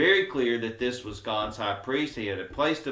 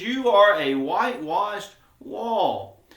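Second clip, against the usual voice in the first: slow, loud, higher-pitched retold rebuke; harsh rebuke; 6 semitones higher, 5 dB louder, slower than usual. Paul rebuking the high priest, slow harsh rebuke. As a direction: deliver a measured rebuke, louder and drawn out. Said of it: "very quick and harsh response".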